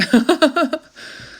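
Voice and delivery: bright, high laugh, loud; short high laugh. Real, not acted. Reader joking that her readings are never short, laughing. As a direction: laugh it off, amused at yourself.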